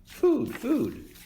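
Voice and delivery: put-on very high, tiny baby bird voice; tiny gosling peep. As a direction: peep it like a hungry chick. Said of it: "peeped the gosling".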